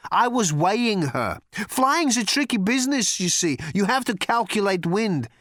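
high, projected explanation; booming thunder god. A narrator's reading. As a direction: declaim it like a god. Thor declaims to Arthur.

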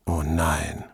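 low, soft worried moan; worried groan. Acted. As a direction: groan it out in dismay. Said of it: "stöhnte Padovani beunruhigt".

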